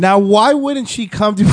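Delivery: cracking up mid-question; words breaking into laughter; laughing. Real, not acted. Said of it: "I couldn't say it without laughing".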